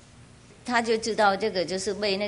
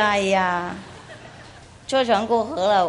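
normal talking voice

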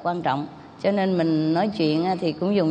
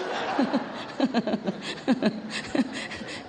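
breathy laugh; 3 semitones higher, 6 dB quieter than usual. Real, not acted. Laughs at own skit.

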